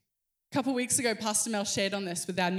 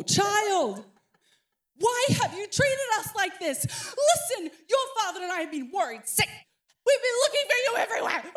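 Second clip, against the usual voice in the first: scolding mother; 10 semitones higher than usual. Acted. Mother finding her son scolds him, half laughing. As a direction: scold the boy with worried exasperation. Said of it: "Let me quote you what Mary said".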